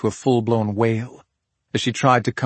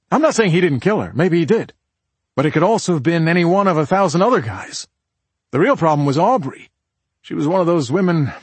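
raised, emphatic man's character voice; pitched-up, exasperated explaining; insistent husband; 6 semitones higher, 4 dB louder than usual. Acted. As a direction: argue the point, higher and pressing. Voices husband arguing his point.

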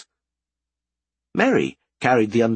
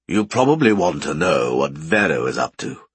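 lower, louder man; man's voice; 6 semitones lower, 3 dB louder than usual. A narrator's reading. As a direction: speak like a sly big man. Thibaut speaks.

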